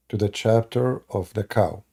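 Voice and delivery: usual speaking voice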